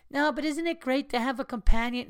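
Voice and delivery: high silly voice of someone defending pets; mocking imagined critic. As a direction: mimic a naive animal lover.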